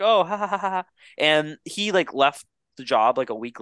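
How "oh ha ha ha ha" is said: higher awkward fake laugh; forced polite laugh. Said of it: "she's like".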